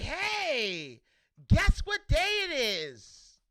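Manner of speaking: playful imitation of his happy daughter. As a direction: mimic an excited little girl's teasing question. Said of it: "She came downstairs happy".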